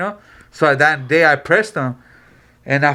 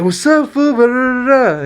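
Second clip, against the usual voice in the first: higher-pitched mock hollered greeting; breezy quoted hello, pitched up; imitated hearty greeting; 10 semitones higher, 4 dB louder, slower than usual. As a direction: imitate a friend rolling up loudly. Friend pulls up greeting the group.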